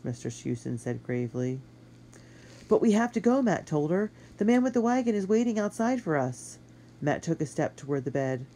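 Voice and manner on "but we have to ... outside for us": put-on urgent boy.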